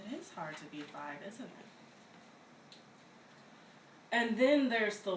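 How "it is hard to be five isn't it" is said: soft aside. Acted. Aside after command.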